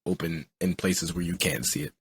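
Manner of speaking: ordinary talk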